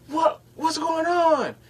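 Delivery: high, slow, fretting coworker voice; mock flustered coworker; panicky coworker. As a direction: pitch up into her panic.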